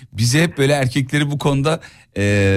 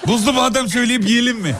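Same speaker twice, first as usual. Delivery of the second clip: laughing offer; 9 semitones higher than usual. Retold or imitated. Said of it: "diyesim geliyor".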